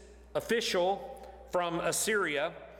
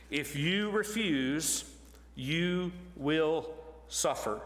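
slow menacing threat; much slower than usual. Retold or imitated. Commander threatens the besieged city.

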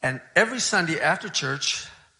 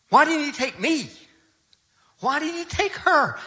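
raw, high-pitched anguished questioning; grieving anger; 4 semitones higher than usual. Retold or imitated. Widower raging at God over his wife, anguished. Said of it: "He was just furious with God".

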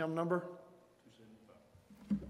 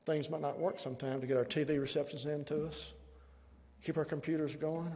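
ordinary talk, nothing marked

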